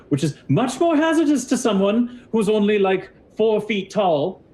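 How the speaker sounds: high, loud, indignant challenge; suspicious lizard wizard. Acted. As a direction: question them warily in a high pompous voice. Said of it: "narrows his eyes and, and looks closer".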